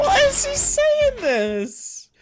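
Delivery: very high, drawn-out wail; squealing, near-crying incredulity; helpless bewilderment. Real, not acted. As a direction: wail the question in disbelief. Baffled by bizarre repeating clip.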